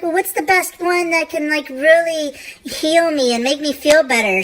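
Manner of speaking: high, pleading mock customer; hopeful customer. Woman wanting a healing stone, high pleading. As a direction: plead for a cure. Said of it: "she was like".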